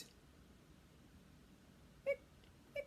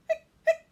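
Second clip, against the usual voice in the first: hiccup sounds; 18 dB louder than usual. Acted. Skeleton hiccuping.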